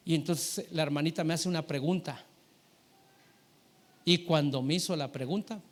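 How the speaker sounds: ordinary talk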